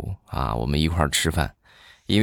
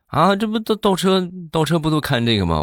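much higher-pitched, stammered protest; flustered sister; 12 semitones higher than usual. Retold or imitated. Sister defends her driving to her husband, mock flustered. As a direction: play the sister squeaking back in self-defence. Sister answers his scolding.

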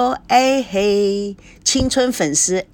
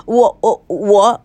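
nervous stammering; 4 dB louder, slower than usual. Acted. Husband stammering nervously before the studio audience. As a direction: stammer 我 over and over, louder each time. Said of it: "他一紧张就结结巴巴地说".

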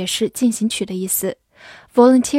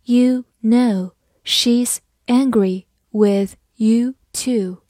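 slow, firm word-by-word reading; slow model sentence with pauses between words; 3 dB louder, slower than usual. Shown as a demonstration. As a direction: say each word separately and slowly. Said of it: "我们再慢慢来读一遍".

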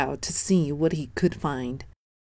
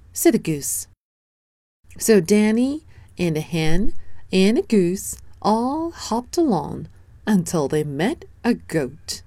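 ordinary delivery

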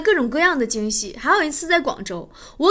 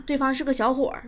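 usual speaking voice

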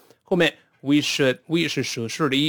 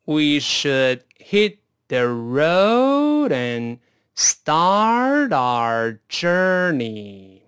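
极慢地读，每个词都拉长连起来; 带学生一词一词慢慢读，元音拖足; 慢速领读; much slower than usual. Shown as a demonstration. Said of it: "先用慢速来一遍". (超慢速示范朗读)

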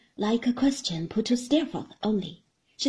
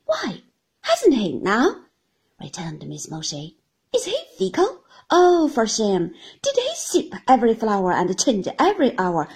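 Miss Mowcher; 4 dB louder than usual. Acted. Miss Mowcher teasing David.

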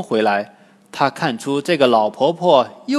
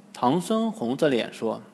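ordinary delivery, nothing marked

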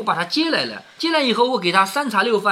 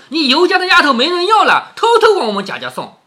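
sharp, scornful scolding, pitched higher; Xifeng spitting scorn; 7 semitones higher, 6 dB louder than usual. Acted. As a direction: spit the words in her face. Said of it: "凤姐儿照脸呸了一口". (凤姐怒斥尤氏，尖刻高声)

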